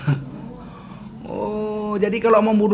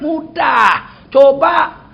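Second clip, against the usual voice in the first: loud, high, cocky call; boastful challenge; 6 semitones higher, 7 dB louder, much slower than usual. Retold or imitated.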